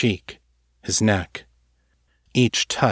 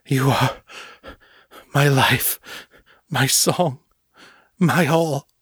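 rising, ever more fervent; building devotion; 4 semitones higher, slower than usual. Acted. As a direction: swell from a breath to a cry.

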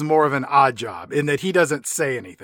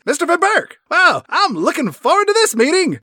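high, hammed-up all-American accent; mock American accent, pitched way up; Colonel Moon; 9 semitones higher, 3 dB louder than usual. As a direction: greet heartily in an exaggerated American accent. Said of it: "in his own accent".

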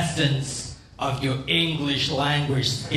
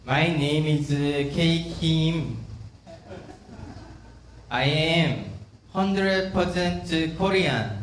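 put-on terrible Korean accent; 3 dB quieter than usual. Comic greeting an American crowd, hammed-up Korean accent. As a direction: put on a heavy Korean accent with careful pacing. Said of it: "I gave you my terrible Korean accent".

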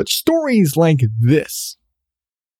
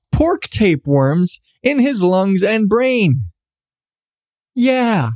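ordinary talk, nothing marked

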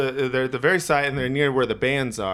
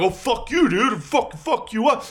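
mock threat; 9 semitones higher than usual. Big guy threatening to fight, high mock tough-guy voice. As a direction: ham up the dude's threat. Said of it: "he's like".

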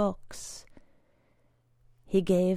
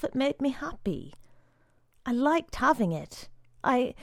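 put-on pleading boy; 5 semitones higher than usual. A narrator's reading. Boy pleads for his caterpillar.

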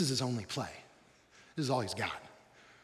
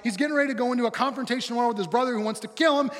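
ordinary delivery

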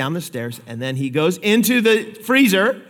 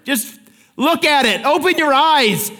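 exasperated parent; 7 semitones higher than usual. Pretend. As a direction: spell it out, fed up with the kid. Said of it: "And you're like".

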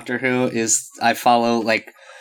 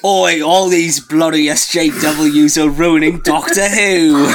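loud, drawn-out hammed-up British accent; mock British fan complaint; 4 semitones higher, 3 dB louder, slower than usual. Shown as a demonstration. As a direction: do an over-the-top indignant Brit, slow and higher. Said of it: "I need you to do a British accent".

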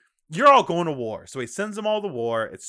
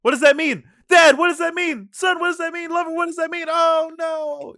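much higher, louder hammed-up anguish; melodramatic wail; 11 semitones higher, 5 dB louder than usual. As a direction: overplay the grieving relative begging for meaning. Hammed-up grief at deathbed.

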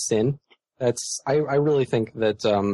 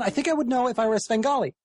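high, fast, strained retort; high, fast, strained comeback; strained response; 11 semitones higher, much faster than usual. Retold or imitated. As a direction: fire it back high and tight, a bit offended. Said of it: "such a strained response".

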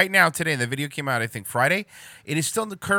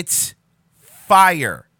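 punchy mock "fire"; loud mocking imitation; 9 dB louder than usual. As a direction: shout the word fire. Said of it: "repeating the line twice".

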